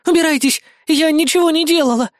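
high, scared woman's voice; frightened sob. A narrator's reading. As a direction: protest in a high, tearful panic. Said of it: "испуганно всхлипнула она".

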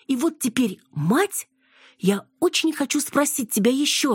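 high-pitched, insistent daughter; mildly indignant female character voice; exasperated daughter. A narrator's reading.

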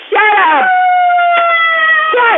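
high, loud shout. Acted. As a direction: snap it at the child. Mother shouting at child.

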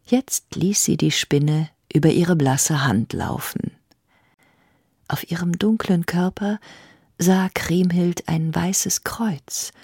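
eerie whispered storytelling; hushed whisper narration. A narrator's reading. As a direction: whisper it like a secret.

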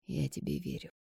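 soft, serious assurance; serious and quiet. A narrator's reading. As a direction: say it quietly, gravely. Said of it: "серьёзно кивнул Никита".

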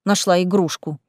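ordinary delivery

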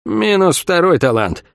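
said through a smile, slow; slow, pleased smiling remark. A narrator's reading. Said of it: "улыбнулась Сойка".

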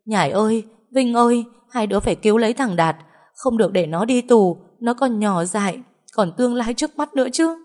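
high, loud wailing plea. A narrator's reading. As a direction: wail and beg your children. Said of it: "khóc ầm ĩ lên". (mẹ khóc ầm ĩ van xin các con cứu em, giọng cao và to)

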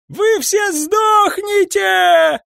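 high, stretched-out shriek; screaming. A narrator's reading. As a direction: scream it as a drawn-out curse. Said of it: "кричал Сайрус". (истошный протяжный вопль)

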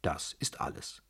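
ordinary delivery, nothing marked

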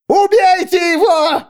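very high, loud pilgrim's screech; shriek. A narrator's reading. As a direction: screech the order, stretching it out. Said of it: "завизжал один из паломников".

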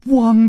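loud, high mock bark; dog bark. A narrator's reading. Chu Liuxiang pretends to be a dog, barking. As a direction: bark suddenly like a playful dog. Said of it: "的一声叫".

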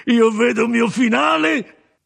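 loud, high, drawn-out mocking cry; sardonic outcry. A narrator's reading. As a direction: cry it out high and slow, full of scorn. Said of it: "disse l'uomo facendo una rumorosa risata sardonica". (grido sardonico, acuto e forte)

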